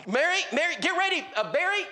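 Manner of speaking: high, repeated calls to his wife; mock urgent yell; stirred-up husband. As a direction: imitate a worked-up man hollering for his wife. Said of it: "he is stirred up".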